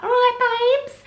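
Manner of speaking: high, loud, drawn-out Essex squeal; hammed-up Essex accent, stretched out and pitched right up. Vlogger imitating the really strong Essex dialect, exaggerated Essex accent. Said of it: "there's different accents and dialects in Essex".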